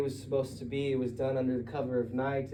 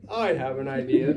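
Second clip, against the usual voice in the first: a hint of an eager know-it-all; lightly voiced eager friend; Peter voice; 8 dB louder than usual. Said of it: "That was Peter".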